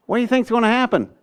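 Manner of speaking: high, fast retold worry; anxious question. Colonel asking what happens next, anxious. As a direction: blurt the question in alarm.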